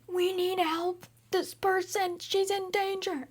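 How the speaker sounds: frantic Joy. Retold or imitated. Joy warning that the person is in danger, high frantic voice. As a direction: play the alarmed little emotion inside her head. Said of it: "She's like".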